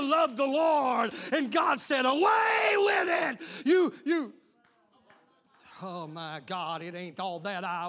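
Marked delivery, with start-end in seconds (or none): 0.0-4.3 loud, high shouted preaching; drawn-out pulpit shout; full-force preaching. Real, not acted. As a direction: thunder God's words at the congregation.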